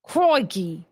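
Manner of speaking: hammed-up Aussie exclamation; attempted Australian accent. Shown as a demonstration. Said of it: "do an Australian accent".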